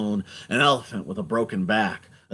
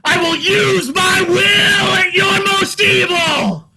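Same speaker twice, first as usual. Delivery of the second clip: high, loud preaching shout; hollering; 9 dB louder than usual. Acted. Man running away hollering at the people, fierce shout. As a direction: holler it at the crowd like a prophet. Prophetic shouting sermon continues.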